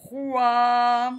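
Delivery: "Froid" loud, with a long held vowel; sounded out. Shown as a demonstration. Teacher summing up the spelling, "Froid" drawn out. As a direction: stretch "Froid" on one note.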